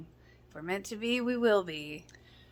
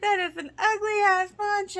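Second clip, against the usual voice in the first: mock Nathan; 11 semitones higher, 3 dB louder than usual. Nathan quoted mocking the poncho.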